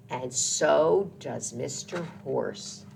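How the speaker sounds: plain reading voice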